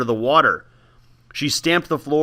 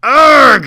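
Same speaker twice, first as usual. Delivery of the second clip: high, loud cry of pain; 12 semitones higher, 11 dB louder than usual. Acted. Old woman burning her hands, pained cry. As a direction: let out a sharp pained argh. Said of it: "she cried out".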